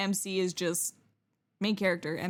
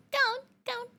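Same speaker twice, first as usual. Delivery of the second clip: excited squeal; much faster than usual. Real, not acted. Cheering on.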